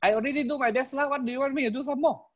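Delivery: high, fast mock complaint; imitating an exasperated excuse-maker; mimicked excuse-maker. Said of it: "you talk like that?".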